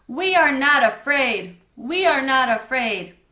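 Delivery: loud, defiant chant; shouting. A narrator's reading. Camp director leads the boys in a chant, shouting. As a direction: shout it out to beat the fear. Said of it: "I want you all to shout with me".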